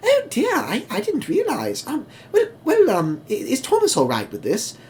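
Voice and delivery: very high, fluttery and surprised; prim carriages twittering in alarm; fussy old coaches. Acted. Coaches realizing Mavis is pulling them, very high and fussy. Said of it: "both the coaches are just like".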